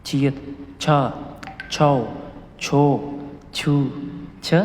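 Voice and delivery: छा, छाओ, छो each said apart with a pause; patient teacher sounding out Korean syllables; syllable drill. Shown as a demonstration. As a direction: read the chart row slowly for students. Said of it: "इनमें से मैं एक करवा देता हूँ आपको".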